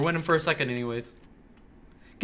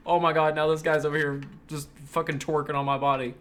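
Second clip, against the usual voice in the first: ordinary delivery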